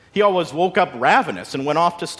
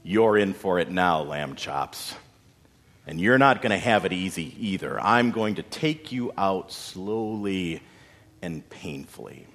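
low and menacing; 6 semitones lower than usual. A narrator's reading. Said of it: "he spoke low and menacing".

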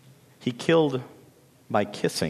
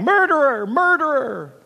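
crying out; 6 dB louder than usual. A narrator's reading. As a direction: cry it out high like fleeing birds. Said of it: "they rose on the wind crying".

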